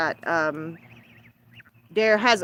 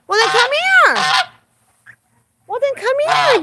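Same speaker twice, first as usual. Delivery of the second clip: sing-song coaxing to a bird; high coaxing pet voice; 6 dB louder than usual. Real, not acted. Owner coaxing her parrot to come see babies, high sing-song. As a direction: coo high and bright to coax the bird out.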